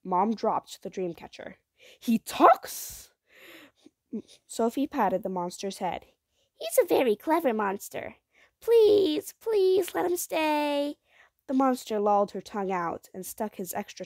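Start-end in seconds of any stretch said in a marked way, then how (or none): 2.0-3.1 high, loud astonished question; surprised. A narrator's reading. Mom shocked by talking monster. 6.6-11.0 put-on high, loud begging child; begging girl. A narrator's reading.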